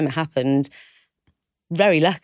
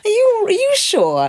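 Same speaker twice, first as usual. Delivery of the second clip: a much higher, louder mock-incredulous mum voice; doubtful mum; 6 dB louder than usual. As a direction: play the disbelieving mother, pitched way up. Mum voiced doubting her daughter.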